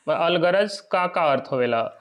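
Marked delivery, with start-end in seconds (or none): none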